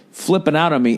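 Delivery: usual speaking voice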